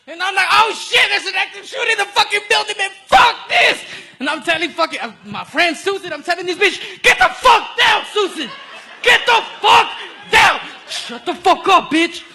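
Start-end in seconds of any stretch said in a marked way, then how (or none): 0.5-3.8 loud, high-pitched frantic yelling; acted panic. Acts out panic at active shooter. 7.0-12.2 loud, high-pitched shouted command; acted frantic order. Acts out yelling at friend Susan.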